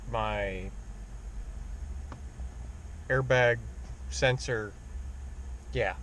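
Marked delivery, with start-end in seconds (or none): none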